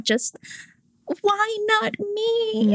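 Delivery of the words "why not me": high, slow pleading cry; a heart crying out. Retold or imitated. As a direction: ache it out, drawn out and high. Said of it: "my heart was crying out".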